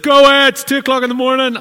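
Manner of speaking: high, loud, fast irritated yell; grumpy shout. Acted. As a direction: shout it fast from behind the door. Grumpy man at door.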